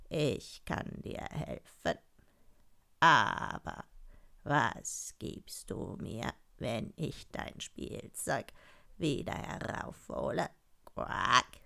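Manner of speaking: slow, low, soft frog voice; low frog with a quack; bargaining frog. Acted. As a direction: draw it out low, end on a croak.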